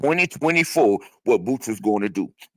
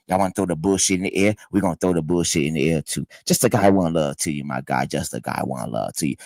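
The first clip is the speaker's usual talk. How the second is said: normal talking voice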